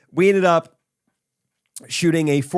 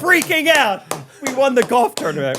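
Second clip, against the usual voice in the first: excited; 11 semitones higher, 3 dB louder than usual. Real, not acted. Thrilled about the tournament win.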